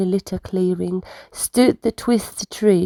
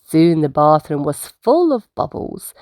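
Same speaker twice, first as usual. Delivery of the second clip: ordinary delivery, nothing marked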